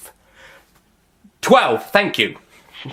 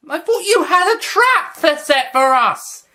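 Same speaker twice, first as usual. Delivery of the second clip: high voiced Doctor; 4 dB louder than usual. Doctor questioning Master.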